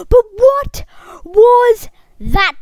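high, loud, emphatic disbelief; incredulous outcry. Real, not acted. Player asking viewers about the glitch, incredulous outcry. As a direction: demand an answer, loud and high.

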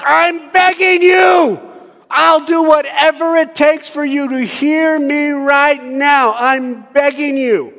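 high, loud, slow begging cry; desperate shouted plea. Retold or imitated. Parent at wits' end begging their child, desperate shout. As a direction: plead at the top of your voice. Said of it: "there's these moments where we're like".

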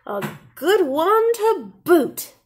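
high, slow crew echo; pirate crew chorus. A narrator's reading. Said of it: "the others agreed".

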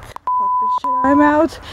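squeaky high raccoon bailing out; a fed-up critter squeaking its exit; the raccoon quitting in a squeaky high voice. Acted. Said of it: "the raccoon is like".

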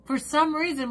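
usual speaking voice

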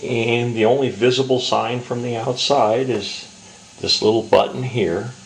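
ordinary talk, nothing marked

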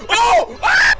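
sudden high scream at a jump scare; startled scream. Real, not acted. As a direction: yelp in real fright. Jump scare in horror game.

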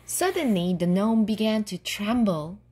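plain reading voice

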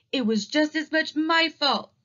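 high cub shout, pitched well up. Acted. Sister claims the blame too, shouting high. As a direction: jump in to share the blame. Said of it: "shouted Sister".

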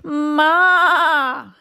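high, loud bleating ma; goat bleat. A narrator's reading. Goat calling to the truck, bleating. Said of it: "said a goat".

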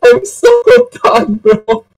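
high, laughing mock of relieved girls; relieved sigh. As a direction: squeak it out like they're finally free. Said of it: "sighing and be like".

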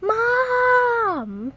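high, drawn-out wailing shout; hammed-up distressed yell; pretend cry for Mom. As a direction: holler for help in make-believe pain. Hurt character calls for Mom.

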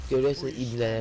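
sick voice. Pretend. Fake sick voice again.

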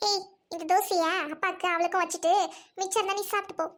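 ordinary talk, nothing marked

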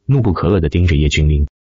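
ordinary delivery

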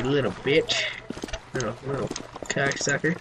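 soft muttered insult; muttered taunt. Real, not acted. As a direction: mutter it under your breath.

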